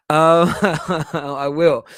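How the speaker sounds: loud burst of real laughter; laughing. Real, not acted. Laughing at his own hair transplant joke. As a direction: laugh through the words.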